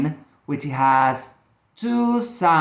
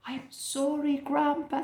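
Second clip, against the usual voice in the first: put-on sorry little girl; 11 semitones higher, 9 dB quieter than usual. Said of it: "said Natty".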